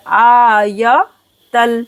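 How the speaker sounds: आयतल with a very long opening aa; slow आयतल, first vowel drawn out; stretched aa. Shown as a demonstration. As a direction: hold the आ, then say yatal. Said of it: "आ को खींचेंगे".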